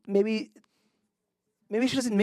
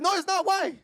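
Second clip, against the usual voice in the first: high retort, only mildly yelled; match her pitch in imitation; mock screaming back; 9 semitones higher than usual. Man retorting to the screaming woman, mild mock yell. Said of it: "I'm screaming back at you".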